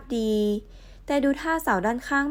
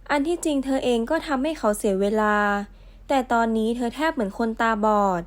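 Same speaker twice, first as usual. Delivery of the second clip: normal talking voice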